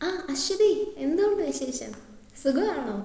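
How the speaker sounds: a hint of a gushing teacher; sweet know-it-all teacher. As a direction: greet a pupil sweetly, a little put on. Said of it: "That sweet, എല്ലാം അറിയാവുന്ന teacher".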